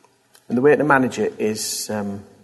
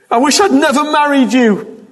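high, loud mock outburst at a spouse; bitter outburst; 12 semitones higher, 7 dB louder than usual. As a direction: fling it out like a furious spouse.

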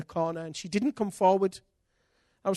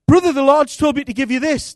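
higher, louder brother delivering news; brother's voice; 8 semitones higher, 13 dB louder than usual. Retold or imitated. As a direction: announce it like a brother on a mission. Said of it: "And he said".

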